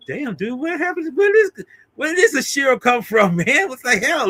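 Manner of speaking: high, stunned mock question; mock stunned bully. Bully amazed at the boy's attack, high mock disbelief. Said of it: "he's just like".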